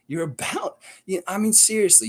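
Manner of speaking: can't believe it, seriously; ranting at American viewers, exasperated. Real, not acted.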